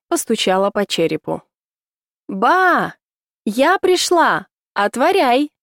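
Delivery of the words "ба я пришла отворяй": raised, drawn-out call at the gate. Acted. Knocking, calling grandmother at gate.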